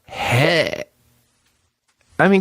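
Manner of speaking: normal talking voice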